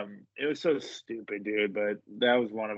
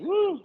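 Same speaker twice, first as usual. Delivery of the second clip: high, loud "woo"; excited whoop; 8 dB louder than usual. Retold or imitated. Fan cheering on a beatdown, retold whoop.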